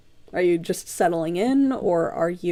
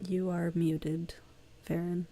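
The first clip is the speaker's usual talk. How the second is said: soft whisper; 9 dB quieter than usual. Real, not acted. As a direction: whisper the aside quietly. GM softly flags muted player.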